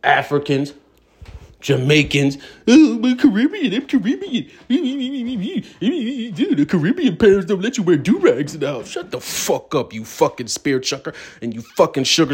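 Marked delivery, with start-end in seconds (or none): 2.7-8.9 high put-on mocking voice, laughing through it; mock Caribbean speaker. Mocking the Caribbean people he complains about, high and sneering. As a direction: sneer while parroting them in a high voice.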